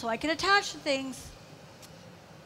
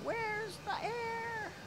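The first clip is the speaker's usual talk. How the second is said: high, slow, worried "Where's the air?"; mock panicked thought; 8 semitones higher, 10 dB quieter, much slower than usual. Telling the audience what she's thinking, a high slow mock worry. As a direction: play the glassblower's inner panic, stretched out. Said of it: "This is the part where we're thinking".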